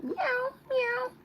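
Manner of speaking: very high, loud squeaky meow; playful kitty meow; cat meow. Pretend. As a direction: mew like a cute kitten. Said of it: "I'm a cute cat".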